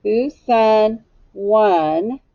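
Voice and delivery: "Whose son won" stretched very slowly with long gaps; slow word by word. Shown as a demonstration. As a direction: sound it out one word at a time. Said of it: "Echo me".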